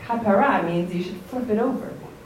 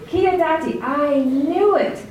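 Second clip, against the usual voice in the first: angry accusation; 7 semitones higher, 4 dB louder than usual. Retold or imitated. Yonah angrily accuses God of being merciful. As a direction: hurl it at God, furious. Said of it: "'cause he's really angry".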